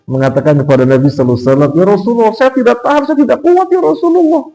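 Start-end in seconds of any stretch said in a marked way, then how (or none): 1.7-4.4 very high, strained mock plea; desperate confession. Umar telling the Prophet he cannot bear it, desperate. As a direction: act out a helpless confession, voice climbing right up.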